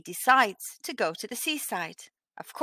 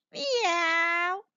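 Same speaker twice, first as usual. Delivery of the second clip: a higher, plaintive meow; cat's meow; 8 semitones higher than usual. Acted. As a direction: mew like an unhappy cat. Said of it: "cried Wilbur".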